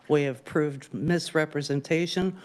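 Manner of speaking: ordinary talk, nothing marked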